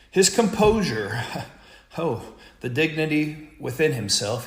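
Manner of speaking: ordinary talk, nothing marked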